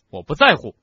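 loud, high, defiant shout; angry yell. A narrator's reading. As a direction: yell it at them in fury. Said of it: "哈利冲着他们大叫，".

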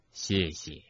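low, soft and tender; softly spoken thanks; gentle soft voice. A narrator's reading. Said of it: "邓布利多柔声说".

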